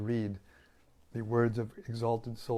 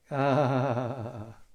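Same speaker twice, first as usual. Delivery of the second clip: loud bursting laugh; hearty laugh; 3 semitones higher, 8 dB louder than usual. Real, not acted. Laughs at praise of voice.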